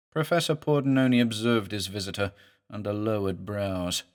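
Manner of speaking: ordinary delivery, nothing marked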